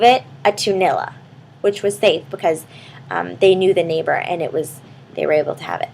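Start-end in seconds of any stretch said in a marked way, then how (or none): none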